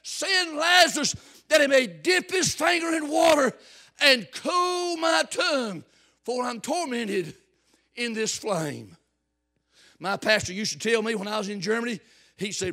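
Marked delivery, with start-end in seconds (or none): 0.0-9.0 loud, high begging shout; rich man pleading. Acted. Rich man in torment pleading with Abraham, loud desperate shout. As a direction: beg for water from the flames. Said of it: "Now he says".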